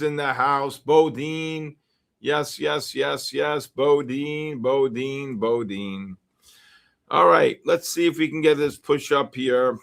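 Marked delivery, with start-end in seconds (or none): none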